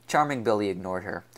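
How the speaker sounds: plain reading voice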